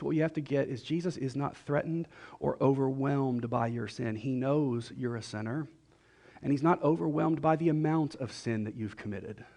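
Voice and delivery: ordinary talk, nothing marked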